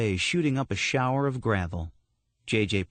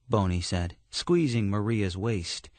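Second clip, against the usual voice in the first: usual speaking voice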